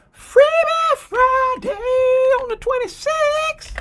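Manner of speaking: high shouted promo; hype shout. Real, not acted. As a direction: announce it like a hype man. Hyping giveaway announcement.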